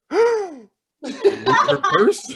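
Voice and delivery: high, loud mock-horrified gasp and words; gasp, then break it with a pause, hammed-up alarm. Older lady eyeing the store worker, mock alarm. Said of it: "looked me up and down and went".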